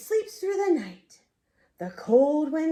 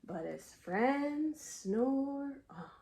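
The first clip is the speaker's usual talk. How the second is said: slow soft refrain; 7 dB quieter, much slower than usual. A narrator's reading.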